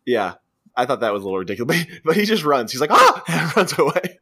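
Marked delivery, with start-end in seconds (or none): 2.9-3.2 very high, loud yelp; short mock scream of fright. Retelling a man fleeing the hazmat suit, mock scream. Retold cry as he flees.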